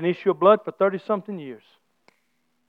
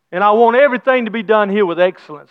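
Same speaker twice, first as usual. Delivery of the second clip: ordinary delivery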